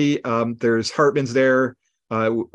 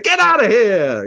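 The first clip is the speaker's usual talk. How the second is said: hammy actor's bellow, louder, higher and slow; Master Thespian outburst; 8 semitones higher, 5 dB louder, slower than usual. Retold or imitated. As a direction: throw it out like a pompous stage actor. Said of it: "He's like".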